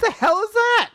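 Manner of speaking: high-pitched comic bafflement; mock outrage. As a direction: squeal it as an indignant joke. Repeating the baffled outcry as joke.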